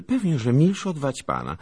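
put-on high, loud girlish jab; pert girl. A narrator's reading. Anusia needles the lieutenant about his friend, pert. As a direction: throw it back cheekily, high.

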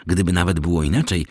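ordinary delivery, nothing marked

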